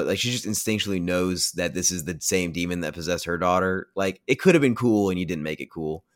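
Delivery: usual speaking voice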